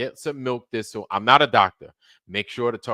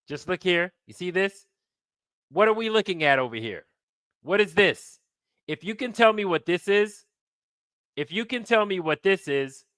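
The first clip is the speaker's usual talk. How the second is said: rough, higher put-on Trump voice; half-practiced Trump impression, pitched up; 7 semitones higher than usual. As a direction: try out an unpolished Trump. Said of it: "I do need to work on my Trump".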